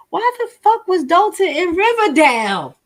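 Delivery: high, loud outraged question; outraged shout. Real, not acted. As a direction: blurt it out in disbelief.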